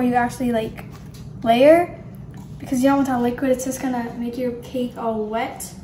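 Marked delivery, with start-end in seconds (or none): none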